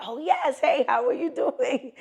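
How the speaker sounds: high, loud cheerful hello; excited greeting. Retold or imitated. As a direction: greet them with big enthusiasm. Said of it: "I'm like".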